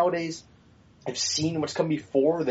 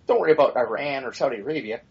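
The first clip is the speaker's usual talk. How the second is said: ordinary talk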